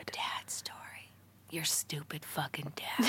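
hushed, slow mock whisper. Teasing a friend about her dad, hushed slow whisper. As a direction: breathe it out like a secret insult.

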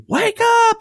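high, sharp yell; boy shouting at his sleepwalking dad; yelled wake up. Retold or imitated. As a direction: shout it to snap him awake. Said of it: "all of a sudden I say".

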